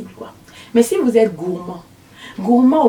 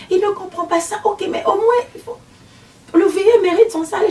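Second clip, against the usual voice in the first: much higher and louder, indignant; father's complaint; 9 semitones higher, 3 dB louder than usual. Retold or imitated. Father insists the old man deserves pay, higher and louder.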